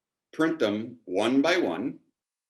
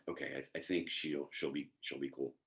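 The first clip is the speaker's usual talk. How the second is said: very quiet, low, halting mumble to self; hushed, hesitant thinking aloud; tentative self-reassurance; 8 semitones lower, 14 dB quieter than usual. Retold or imitated. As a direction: reassure yourself under your breath, unsure. Reassuring himself after apology email.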